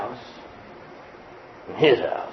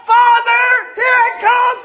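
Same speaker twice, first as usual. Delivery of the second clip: pleading shout to Abba Father; yelling out to God; much slower than usual. Acted. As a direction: cry out to the Father with everything. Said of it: "we need to yell out".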